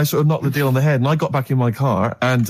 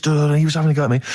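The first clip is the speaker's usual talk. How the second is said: usual speaking voice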